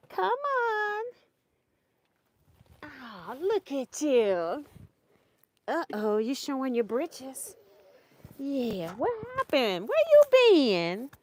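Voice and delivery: high cooing talk to a grandchild on camera. Real, not acted. Talking to toddler on camera.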